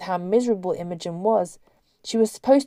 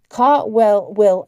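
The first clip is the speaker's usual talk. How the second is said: ordinary delivery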